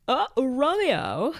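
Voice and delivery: high, slow, drawn-out sigh of a name; hammed-up dreamy Romeo; mock swooning Romeo. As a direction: swoon the name like a lovesick fool. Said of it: "the way he said it".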